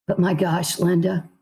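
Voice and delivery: ordinary talk, nothing marked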